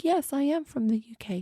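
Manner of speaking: prim imitation British accent; put-on British accent. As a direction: answer as a pretend Brit. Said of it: "British accent conversation".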